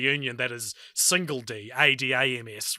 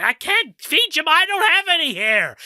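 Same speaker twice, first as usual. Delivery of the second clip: much higher, louder mock Fester; Fester to his pet; 13 semitones higher, 6 dB louder than usual. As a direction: squeak it out like Uncle Fester pleading with his pet. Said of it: "He's like".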